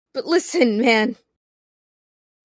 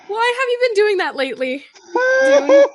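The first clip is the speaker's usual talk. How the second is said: laughing; 9 semitones higher, 3 dB louder, faster than usual. Real, not acted. Repeats question through laughter.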